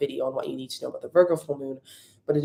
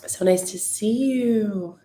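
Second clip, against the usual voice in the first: high, slow, stretched greeting; sing-song good morning; 6 semitones higher, slower than usual. Real, not acted. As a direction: drawl it up high and sweet. Greeting viewers joining live.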